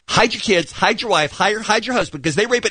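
high-pitched mock warning, rushing at the end; retold catchphrase. Radio host retelling what the interviewee shockingly said, high-pitched. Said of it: "Then she says".

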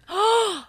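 sharp, high mock gasp of shock; a theatrical fake gasp before the jab. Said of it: "with the most sarcastic".

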